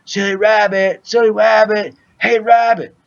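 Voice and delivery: mild mock holler over the mic; mock yelling. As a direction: imitate friends hollering to wake someone. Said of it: "Everybody's yelling on the mic".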